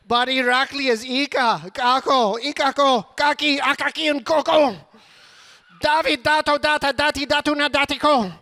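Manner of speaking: rapid-fire list. Acted. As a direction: rattle off names breathlessly.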